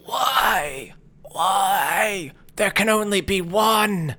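high, drawn-out dramatic wail; pummelled double wailing theatrically; evil double's cry. Acted. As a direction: cry it out as the hammed-up doppelganger. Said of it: "guy that looks just like you, and he says".

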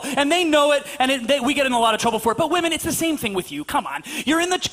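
ordinary delivery

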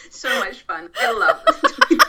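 can barely get the words out for laughing. Real, not acted. Guest laughing at the host's Australian accent, speaking through laughter.

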